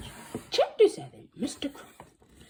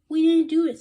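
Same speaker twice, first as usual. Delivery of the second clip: loud, high denial; protesting; 10 semitones higher, 15 dB louder than usual. Acted. Boy denying to the principal, loud high protest. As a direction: protest loudly.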